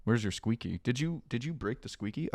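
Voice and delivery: soft, quiet pet voice; talking to a pet. Real, not acted. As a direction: ask the dog tenderly. Talking to dog.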